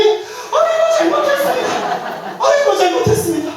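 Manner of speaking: very high wailing 아이고, half laughing; hammed-up begging for mercy; mock pleading. Chastened old laywoman begs the monk's pardon, mock wailing.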